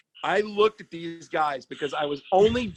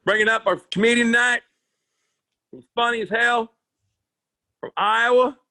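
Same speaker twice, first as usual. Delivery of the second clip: announcer intro; 4 semitones higher, 5 dB louder than usual. Retold or imitated. Bartender announcing comedian loudly.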